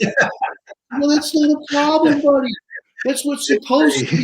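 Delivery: that's no problem, buddy, gleefully; delighted amusement. Retold or imitated. Laughing off Kyle's earthworm worry, very high and loud.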